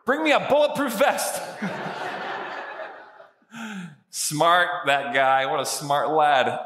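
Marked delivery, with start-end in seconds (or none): 0.0-1.4 cheeky shout. Retold or imitated. The condemned murderer to the firing squad, cheeky shout. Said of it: "this is what he says". 1.5-2.9 breathy laugh. Real, not acted. Laughs after punchline.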